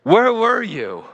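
high, slow, pleading cry of "Where were you?"; anguished cry to God. Retold or imitated. Man crying out to God in his heart, anguished high cry. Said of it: "he cried out to God in his heart".

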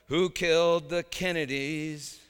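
loud, slow cry of the lyric line; shouted lyric. Retold or imitated. Preacher quoting [name]'s line, shouted out. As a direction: call it out like a rock singer. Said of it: "I shouted out".

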